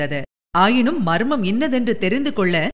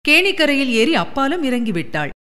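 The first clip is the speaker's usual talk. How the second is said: ordinary delivery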